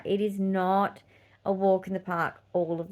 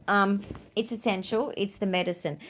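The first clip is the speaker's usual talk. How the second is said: ordinary delivery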